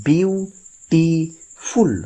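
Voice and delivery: Beautiful very slow, long pauses between বিউ, টি and ফুল; high, level chant-like beats on beautiful, broken in three; three separate syllables. Shown as a demonstration. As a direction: stretch each syllable of beautiful and stop after each. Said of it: "তিনবার কিন্তু জিভটা বাধা পাচ্ছে".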